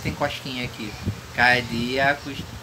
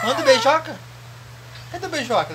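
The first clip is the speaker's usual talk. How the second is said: put-on higher coaxing baby talk; 10 semitones higher, much faster than usual. Father coaxing the baby to blow a kiss.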